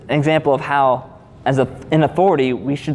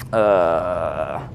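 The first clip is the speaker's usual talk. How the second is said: 1 caught-out uh; 10 semitones lower, 3 dB quieter than usual. Retold or imitated. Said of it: "And they're like".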